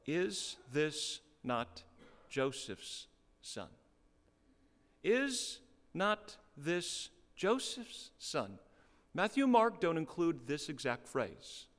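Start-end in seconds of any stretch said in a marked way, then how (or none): 0.0-8.6 slow, deliberate "Is this not Joseph's son?" with weight on "Is"; slow pointed question. Shown as a demonstration. Preacher reading the townsfolk's question, slow and pointed. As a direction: lean into each word of "Is not this Joseph's son?". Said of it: "Luke just says this one question".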